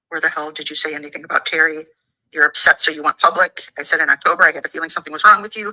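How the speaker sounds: ordinary talk, nothing marked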